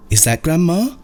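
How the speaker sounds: put-on high, small voice of a young child; child's voice. A narrator's reading. Grandchild answering the phone, high childlike voice. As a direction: pipe it up like a little grandchild.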